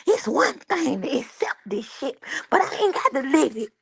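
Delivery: high, fast mock scolding; mom scolding Tim. Mom throwing Tim out of her house, high mock scolding. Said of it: "'cause she was like".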